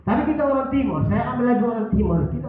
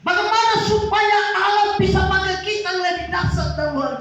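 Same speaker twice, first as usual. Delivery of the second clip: fiery preaching; 9 semitones higher, 5 dB louder than usual. Acted. Preaching at full force.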